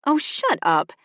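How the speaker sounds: high, loud dismissal; exasperated retort. Acted. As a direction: shut him down with annoyance. Sister snapping back.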